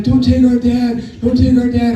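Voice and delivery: high-pitched mock wail of protest; mock crying plea. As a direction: plead as if crying, half in earnest. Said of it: "My other brother starts crying".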